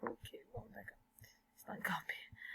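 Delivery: muttered under her breath; whispered aside. Real, not acted. Teacher fighting the exercise page, whispering to herself. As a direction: whisper it to yourself, exasperated. Computer trouble, muttering to self.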